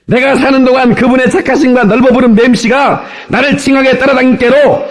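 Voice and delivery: high, rousing Jeolla dialect declamation; 전라도 사투리 낭송. Shown as a demonstration. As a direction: belt out the psalm in comic Jeolla dialect. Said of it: "전라도 버전을 제가 따왔습니다". (높은 목청의 전라도 사투리 낭송)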